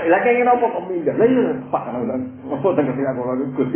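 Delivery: high, loud back-and-forth; heated mock argument. Preacher acting out a quarrel over a runaway wife, high and loud. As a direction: bark it like two men quarrelling.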